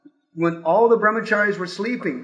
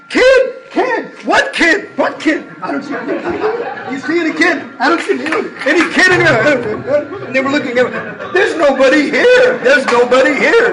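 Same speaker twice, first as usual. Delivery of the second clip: mock innocence; 7 semitones higher, 7 dB louder than usual. Feigning innocence to police, laughing.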